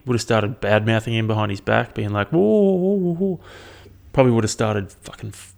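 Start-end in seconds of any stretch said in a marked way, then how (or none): 2.3-3.4 high put-on whoas; a silly, high mocking whoa. Mocking the deaf man's reaction.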